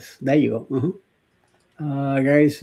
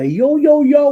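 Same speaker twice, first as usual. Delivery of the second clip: high, loud mock yell of a Bronx character; imitating the Bronx guy who screams; screaming Bronx guy; 13 semitones higher, 7 dB louder, slower than usual. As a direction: belt it out like a crazy Bronx guy. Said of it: "the one that screams".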